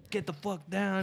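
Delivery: mock soldier order. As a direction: order them down like a colonist soldier. Acted soldier commanding.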